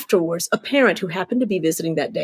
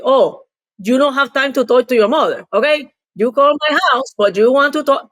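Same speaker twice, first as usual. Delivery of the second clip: higher, accented mock mother's complaint; scolding mother; 6 semitones higher, 4 dB louder than usual. Daughter retelling her mother's phone complaint, higher mock scolding voice.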